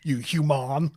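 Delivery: "human" as hew-MAHN; mock Ferengi, saying "human" with a long final "ah"; Ferengi pronunciation. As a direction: say "human" with the end rhyming with "on". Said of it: "like a Ferengi".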